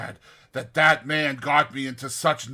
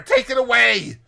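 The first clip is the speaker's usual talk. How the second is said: even higher, louder, near-shriek; rising panicked cry; 10 semitones higher, 9 dB louder than usual. Retold or imitated. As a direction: panic peaks on the repeat. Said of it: "Next he cries".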